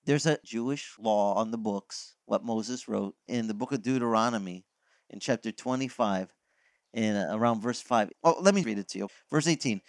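ordinary talk

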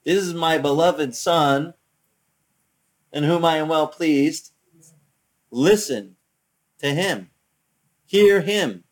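slow, loud, measured proclamation; voice of God. Retold or imitated. Preacher quoting heaven's words to Peter, slow proclamation. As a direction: proclaim it slowly with weighty pauses. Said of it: "he told you three simple words".

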